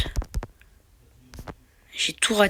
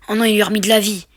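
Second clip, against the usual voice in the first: normal talking voice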